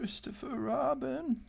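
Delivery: high, slow Pooh voice; Pooh impression. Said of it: "That's my best Pooh impression there".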